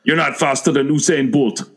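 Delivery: imitated Jamaican accent, firm retort. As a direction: answer back in a put-on Jamaican accent. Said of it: "all the Jamaicans in the show were like".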